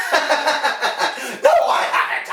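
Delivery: high, laughing denial; laughing reply. Retold or imitated. Barber answering coworkers about being hungry, laughing. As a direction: laugh through the no. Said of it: "They're like, [name] hasn't eaten yet".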